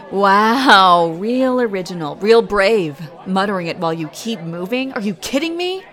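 loud, biting sarcasm, rising in disbelief; mock how weak he is; sarcastic scorn. Sneering at the bully for muttering insults.

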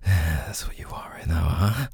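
breathy unvoiced whisper, close to the mic. Lover to partner in bed, whispered. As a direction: breathe it out without voice. Intimate roleplay, whispered line.

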